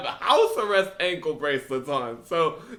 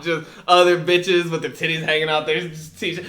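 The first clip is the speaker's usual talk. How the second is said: ordinary talk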